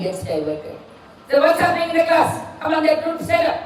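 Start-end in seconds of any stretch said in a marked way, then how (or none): 1.4-3.6 loud, raised teacher's call to order; scolding the class. Acted. Teacher calls out the giggling birthday group, loud and stern. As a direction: snap the giggling group to attention.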